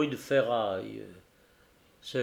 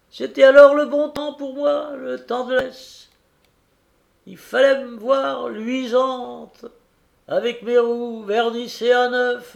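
put-on high, cracked old coach voice; old fairy voice; 9 semitones higher, 7 dB louder than usual. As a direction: speak as a creaky old stagecoach. Old diligence continues speaking.

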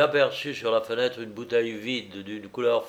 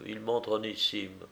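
usual speaking voice

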